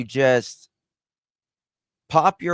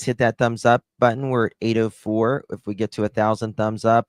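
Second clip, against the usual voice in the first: ordinary talk, nothing marked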